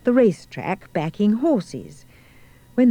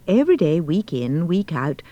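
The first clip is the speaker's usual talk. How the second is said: ordinary delivery, nothing marked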